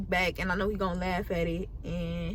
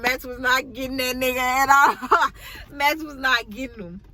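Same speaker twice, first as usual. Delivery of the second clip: laughing; 3 semitones higher, 4 dB louder than usual. Real, not acted. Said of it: "laughs heard".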